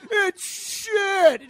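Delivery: loud, high, repeated mock yell; hammed-up scream at the mess; imitating a man shouting at the foam; yelling declaration. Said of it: "yelling at it".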